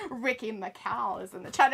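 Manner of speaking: ordinary talk, nothing marked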